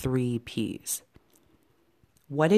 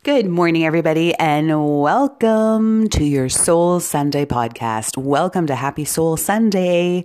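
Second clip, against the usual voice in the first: loud, higher-pitched show welcome; podcast host's opening; 3 semitones higher, 7 dB louder than usual. Acted. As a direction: belt out a big welcome to listeners. Opens her podcast with greeting.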